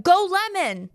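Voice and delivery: loud, high-pitched sports chant; mock fan cheer. Fan cheering a player with a funny name, pretend shout. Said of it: "We're gonna have to be going".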